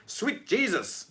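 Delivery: high, drawn-out Southern American exclamation; Southern American accent. Acted. Reading out viewer's comment, mock Southern American accent. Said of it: "Got to say that in, like, a Southern American accent".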